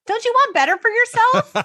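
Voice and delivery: scolding the singer in disbelief; incredulous. Retold or imitated. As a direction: ask it in disbelief. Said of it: "I'm like".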